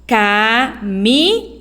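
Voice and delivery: Camisa slowly, S voiced as a clear Z. Shown as a demonstration. Teacher repeating Camisa slowly, showing the Z sound. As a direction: draw out Camisa to let them hear the Z. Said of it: "O som é de Z".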